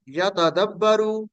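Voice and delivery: slow, syllable by syllable यतदब्बरू; teacher slowing down for learners; said slowly. Shown as a demonstration. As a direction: ease through यतदब्बरू. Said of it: "आपने आराम से बोलना है".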